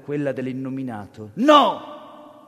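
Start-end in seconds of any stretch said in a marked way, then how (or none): 1.4-2.4 sharp loud cry of refusal; a loud desperate No; shout. Acted. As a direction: shout it, pulling away. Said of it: "gridò questo".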